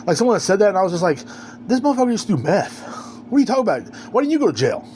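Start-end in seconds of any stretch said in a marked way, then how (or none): 1.7-4.8 higher-pitched disbelief; indignant, pitched-up questioning; incredulous comeback. Retold or imitated. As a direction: quote yourself calling out a hypocrite. Quotes own incredulous comeback.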